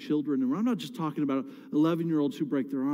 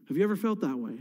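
ordinary talk

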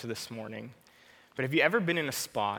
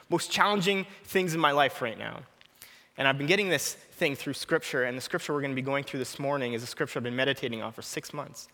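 ordinary talk, nothing marked

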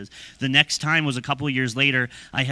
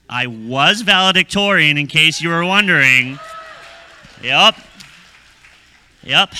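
mock boast; 3 semitones higher, 10 dB louder than usual. Mock bragging about graduation.

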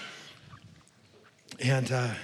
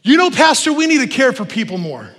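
complaining churchgoer; 7 semitones higher, 7 dB louder than usual. Retold or imitated. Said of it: "the symptoms kinda sound like this".

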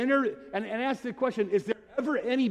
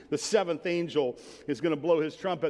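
normal talking voice

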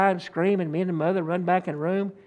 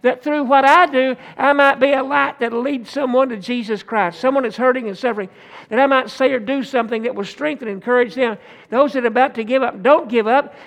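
full-force preaching; 3 semitones higher than usual. Acted. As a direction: preach it out, urging them not to quit. Preaching to congregation.

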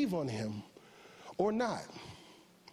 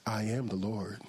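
voice of the Lord; 7 semitones lower, 3 dB quieter, slower than usual. Retold or imitated. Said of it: "The Holy Spirit will slide into your car and say".

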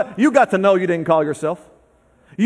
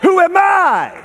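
loud, high fervent preaching; preaching at full force; 9 semitones higher, 5 dB louder than usual. Acted. Preacher answering doubt with a loud fervent cry. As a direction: hammer the answer home.

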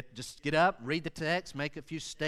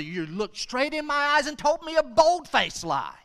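high-pitched, indignant parental scolding; hammed-up incredulous parent, voice shooting up; outraged parent; 12 semitones higher, 7 dB louder than usual. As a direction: scold a lying child in disbelief. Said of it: "the ones that parents hate the most".

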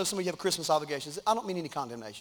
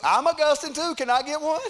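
mock Judy; 9 semitones higher, 7 dB louder than usual. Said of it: "Judy put it on Facebook".

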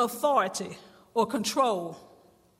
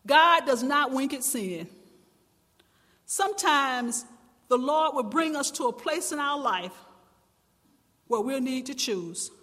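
ordinary talk

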